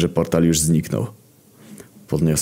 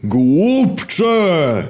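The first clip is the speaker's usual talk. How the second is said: high, drawn-out villain shout; hell-shaking bellow; 12 semitones higher, 4 dB louder than usual. Acted. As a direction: roar so all hell trembles. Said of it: "Całe piekło zadrżało".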